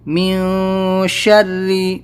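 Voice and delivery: مین شر ری drawn out very slowly with hidden n; slow ikhfa. Shown as a demonstration. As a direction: stretch مین شر ری slowly, nasal hiding the n. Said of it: "اس لیے یہاں پر ہم اخفا کریں گے". (مین شر ری آہستہ، اخفا کے ساتھ)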